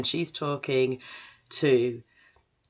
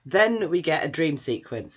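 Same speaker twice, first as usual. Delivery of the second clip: usual speaking voice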